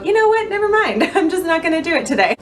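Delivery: high, fast mock giving-up voice. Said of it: "and then I go".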